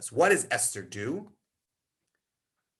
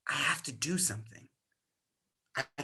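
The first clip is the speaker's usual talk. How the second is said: whispered vow; 2 semitones lower, 10 dB quieter than usual. Acted. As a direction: breathe it out unvoiced. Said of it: "whispered climax".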